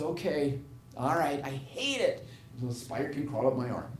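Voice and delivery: soft, grudging acted consent; retold reluctant surrender. Woman letting the spider crawl up her arm, retold reluctant surrender. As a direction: give in quietly, as her.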